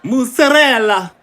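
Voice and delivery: MOZZARELLA high and slowly stretched out; exaggerated mozzarella. Shown as a demonstration. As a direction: interject "Mozzarella" as a drawn-out mock catchphrase. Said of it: "You gotta say it like".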